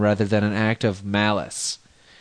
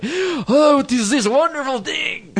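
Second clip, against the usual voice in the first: bad Scottish accent; 14 semitones higher, slower than usual. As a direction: squawk it in a hopelessly botched Scottish accent. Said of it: "That was a really bad Scottish accent".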